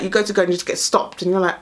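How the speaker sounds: normal talking voice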